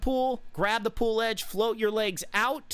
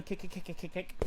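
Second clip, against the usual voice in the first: softer, a steady kick, kick, kick; rhythmic counting of kicks; 10 dB quieter, faster than usual. Shown as a demonstration. Coach showing the kick rhythm, softly chanting. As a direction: tap out the kick rhythm under your breath. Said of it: "kicking like this".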